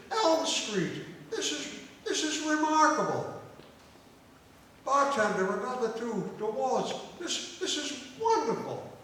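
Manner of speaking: high, loud exclaiming; astonished delight. Acted. Drinker celebrating the coincidence with another round, astonished delight. Irishmen marvel at same street.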